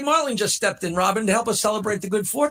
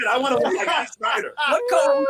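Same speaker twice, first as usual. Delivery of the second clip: high excited yell; excited shout; 5 semitones higher than usual. Retold or imitated. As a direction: blurt it out eagerly.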